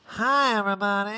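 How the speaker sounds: high mimicked greeting; Erica. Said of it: "And Erica's like".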